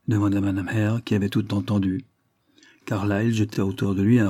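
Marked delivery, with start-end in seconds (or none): none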